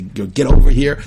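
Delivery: loud angry snap; angry call. Shown as a demonstration. Teacher demos an angry call to children, loud and angry. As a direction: bark it like yelling at a kid. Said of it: "you can say it with anger".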